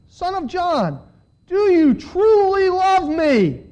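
high, slow, pleading question; impassioned questioning. A narrator's reading. Jesus asking again, more intensely.

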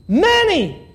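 high, ringing call; one loud pulpit outburst; emphatic exclamation. Acted. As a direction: proclaim it suddenly to the room. Quotes scripture word as exclamation.

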